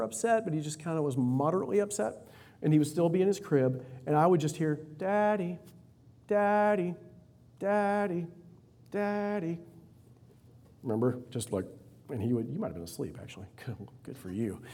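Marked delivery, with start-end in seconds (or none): 5.0-9.6 put-on high little child calling Daddy; toddler calling. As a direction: call out like a fussy toddler.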